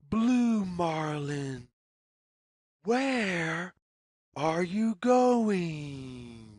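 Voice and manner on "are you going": ordinary delivery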